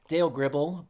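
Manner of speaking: ordinary talk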